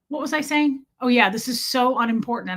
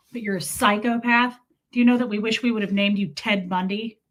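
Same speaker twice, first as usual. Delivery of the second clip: usual speaking voice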